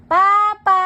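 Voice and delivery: high, loud, drawn-out call. Real, not acted. Parent calls Papa to tell on Luna, calling out. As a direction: call for someone to come.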